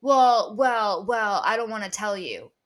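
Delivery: loud, drawn-out evasive stammer; mock stalling. Rumor starter dodging who told them, mock stalling. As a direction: play someone cornered, stalling loudly on each word.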